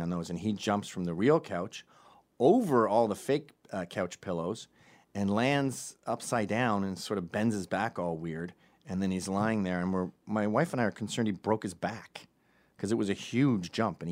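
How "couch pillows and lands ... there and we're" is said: normal talking voice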